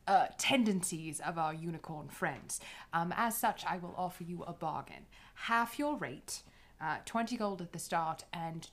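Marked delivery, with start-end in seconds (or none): none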